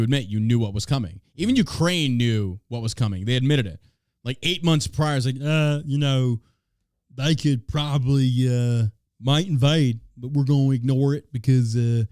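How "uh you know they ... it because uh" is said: badly faked slow Ukrainian accent. Host mimicking Ukraine months before the invasion, bad Ukrainian accent. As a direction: put on a terrible Ukrainian accent, slowly. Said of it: "That is the worst Ukrainian accent I could ever try".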